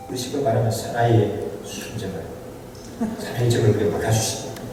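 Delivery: ordinary delivery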